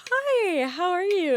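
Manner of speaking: mock the overly cheerful clerk; chirpy clerk greeting. Desk clerk's cheery greeting imitated.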